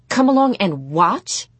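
put-on appalled teenage question, sharply rising at the end; incredulous echo. A narrator's reading. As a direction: throw it back in disbelief. Prudence echoes the offer, appalled.

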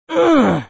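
a high loud strained grunt; protesting grunt. A narrator's reading. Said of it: "Kyle protested".

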